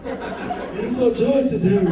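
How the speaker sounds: slow, laughing Dumbledore line; laughing quote. Retold or imitated. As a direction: drag it out while laughing. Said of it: "repeated Dumbledore quote".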